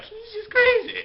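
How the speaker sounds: shrill high mock lady voice; Lady Macbeth. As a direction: squeal it like a fussy noblewoman. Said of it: "And Lady Macbeth's like".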